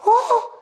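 a high startled "Oh"; high gasp. Retold or imitated. Woman hit by the Spirit gasps high. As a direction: gasp up high as the healing hits. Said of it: "And she went".

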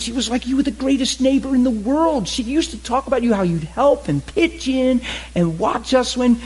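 high, eager exclaiming; thrilled to place the old neighbor; excited man greeting a family friend; delighted recognition. Pretend. Pretend reunion with old neighbor.

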